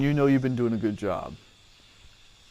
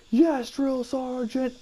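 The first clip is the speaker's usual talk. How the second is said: high, drawn-out recruit's reply; recruit answering; 10 semitones higher, much slower than usual. Retold or imitated. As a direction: sound off to the drill sergeant. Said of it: "I'm like".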